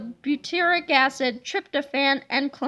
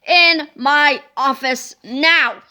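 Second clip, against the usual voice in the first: slow, loud, stretched-out shout; shouted order; 2 semitones lower, 7 dB louder, much slower than usual. A narrator's reading. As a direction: bellow each word at them. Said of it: "he shouted".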